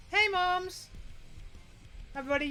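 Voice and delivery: normal talking voice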